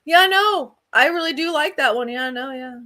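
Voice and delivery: high, over-keen agreement that trails down; feigned approval. Retold or imitated. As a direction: imitate a partner humouring you, fading out. Imitates partner half-asleep feigning approval.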